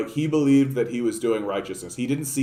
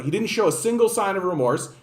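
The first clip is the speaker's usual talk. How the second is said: normal talking voice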